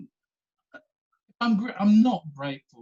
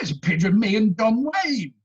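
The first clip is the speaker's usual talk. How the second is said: put-on higher, louder, cracking old man's voice; proud old man; 6 semitones higher, 6 dB louder than usual. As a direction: show off the photo with pride.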